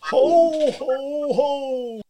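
booming jolly Santa laugh; big hearty ho ho ho; Father Christmas ho ho ho. Pretend. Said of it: "that was me, Father Christmas".

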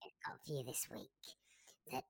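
fast unvoiced gossip whisper; secret whisper. Informant whispering rumours to the podcast host. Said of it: "Keep that quiet".

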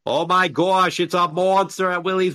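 exaggerated mock Boston accent. Defending Boston with a demonstration, exaggerated Boston accent. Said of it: "How can Boston be unattractive".

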